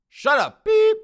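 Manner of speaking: very high, very loud yell; angry shout. Acted. Son shouting down his girlfriend, angry shout. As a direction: explode to shut someone up.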